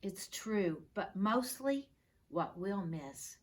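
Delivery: lilting sing-song recital, building to a cheer; poem read aloud. Acted. Class poster read to the teacher, recited with growing cheer.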